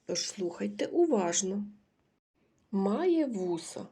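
ordinary talk, nothing marked

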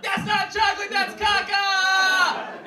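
high, very loud shriek, last word held long; horrified kid scream. Retold or imitated. Second grader finding out the chocolate trick, shrieking. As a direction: scream it out, stretching the final word.

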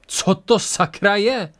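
startled shout breaking into the scene; calling out. A narrator's reading. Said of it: "přerušil zavoláním".

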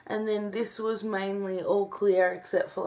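normal talking voice

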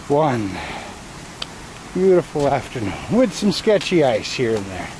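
ordinary talk, nothing marked

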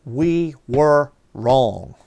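"We were wrong" loud, word by word; very slow, weighty "We... were... wrong"; emphatic admission. Retold or imitated. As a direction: stretch and punch each word of "We were wrong". Spells out the refused admission.

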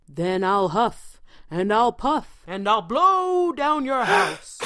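wolf voice. A narrator's reading. Wolf threatens again.

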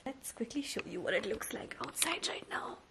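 hushed unvoiced whisper. Real, not acted. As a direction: whisper it softly. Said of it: "I don't know why I'm whispering".

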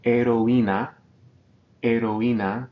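Heroína with o and í apart; syllables separated. Shown as a demonstration. Teacher models Heroína, vowels split apart. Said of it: "we're separating these".

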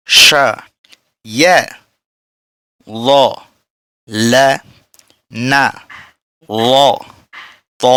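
each letter sound said alone with a pause after; clear, separate syllables for learners to copy; sound-only recitation. Shown as a demonstration. Teacher saying only the sounds for students, slow and clear. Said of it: "আমরা শুধু এইবার উচ্চারণ করি".

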